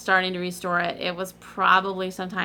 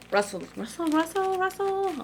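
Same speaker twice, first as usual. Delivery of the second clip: sing-song rustle, jumping much higher; playful rustle sound; 9 semitones higher, 4 dB quieter than usual. Pretend. To viewers, mocking her noisy craft bags, playful high sing-song. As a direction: mimic the crinkling bags in a silly high lilt.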